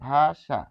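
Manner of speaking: "ভাষা" in two clear syllables, bha-sha; demonstrated pronunciation. Shown as a demonstration. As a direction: say "ভাষা" with a sh sound. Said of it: "ততবারই তালব্য স আসবে". ("ভাষা" তালব্য শ দিয়ে উচ্চারণ)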